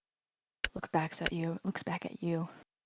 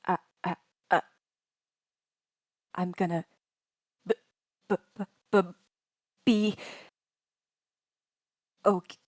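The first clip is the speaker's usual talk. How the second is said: stuttering android, a touch louder; glitching robot; 7 dB louder, faster than usual. Acted. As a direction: stammer like a malfunctioning droid. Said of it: "The android mumbles after a bit".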